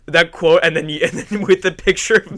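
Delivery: talking loudly through laughter; laughing hard. Real, not acted. Host cracking up at own joke, laughing hard. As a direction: push the words out while cracking up.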